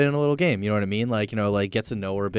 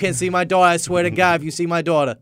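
loud, higher Long Island Italian dad; 6 semitones higher, 4 dB louder than usual. Pretend. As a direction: bark it like a protective Italian father. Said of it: "Of course, Paulie".

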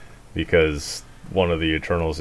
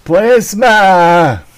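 vampire shout; 12 semitones higher, 10 dB louder than usual. Pretend. Joking about vampire Morbius.

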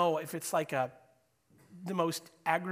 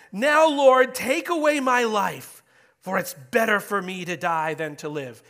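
high, loud, whiny Jonah complaining to God; whiny angry voice; 4 semitones higher, 12 dB louder than usual. Acted. Jonah complaining to the Lord in a high, loud whine. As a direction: whine at God like a furious, self-pitying prophet. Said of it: "the coup de whine".

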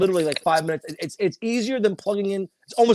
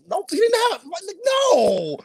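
exasperated protest; 14 semitones higher, slower than usual. Real, not acted. Arguing with fans about the Joker's motives, exasperated shout. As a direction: shout down a silly theory.

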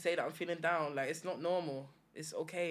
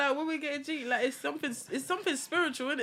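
laughing; 9 semitones higher than usual. Real, not acted. Joking about getting jiggy.

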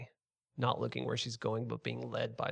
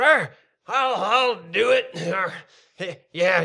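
loud, higher, slurred-slow pirate bluster; drunken pirate cheer; 8 semitones higher, 10 dB louder than usual. Acted. Drunk fisherman volunteering to his mates, loud pirate bravado. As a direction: bluster like a tipsy sailor. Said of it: "drunken people going".

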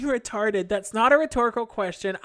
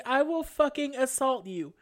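normal talking voice

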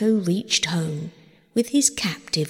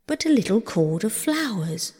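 plain reading voice

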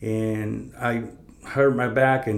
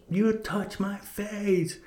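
high, slow, disbelieving; stunned bully; 10 semitones higher, much slower than usual. Retold or imitated. Slapped bully to the coach, high and disbelieving. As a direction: drag it out high like a shocked giant.

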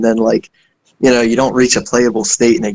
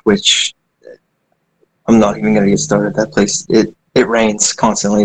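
ordinary delivery

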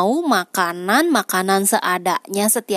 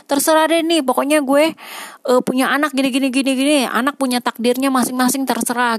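higher and louder, careless 'whatever' voice; carefree mock parent; 5 semitones higher, 4 dB louder, faster than usual. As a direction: play a parent tossing it all to fate. Imitating a careless would-be parent.

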